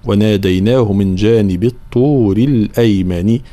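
slow tajweed chant, kasra ending on "الأيمن"; recitation model. Shown as a demonstration. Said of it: "هكذا". (ترتيل بطيء بكسر نون الأيمن)

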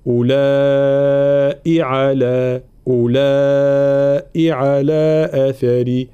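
slow chanted أولئك, long laa and short final i; short final hamza. Shown as a demonstration. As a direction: hold the laa of أولئك, clip no other madd onto its hamza. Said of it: "الهمزة الأخيرة المكسورة ليس بعدها مد". (أولئك بهمزة مكسورة دون مد بعدها)

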